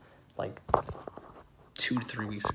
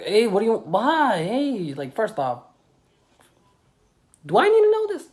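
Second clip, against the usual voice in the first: much higher and louder, climbing to a squeal; incredulous outburst; 12 semitones higher, 14 dB louder than usual. Retold or imitated. Reacting to John's disturbing overshare, loud incredulous squeal. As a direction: disbelief building to a shriek.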